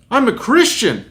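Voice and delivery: high-pitched mock declaration; acted earnest protest. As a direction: imitate a flustered man blurting out his faith. Acted driver's startled declaration.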